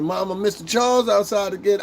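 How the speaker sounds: put-on high kid's voice calling to mom; a little kid telling mama; child voice. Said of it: "You'd be like".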